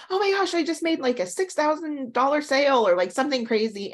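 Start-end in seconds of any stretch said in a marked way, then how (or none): 0.1-2.9 high, thrilled gush from an imitated student; excited student. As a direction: retell a student's bragging delight. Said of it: "a student will be like".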